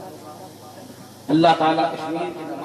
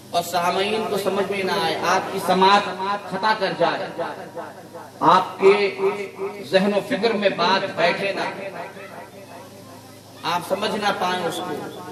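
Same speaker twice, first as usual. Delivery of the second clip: ordinary talk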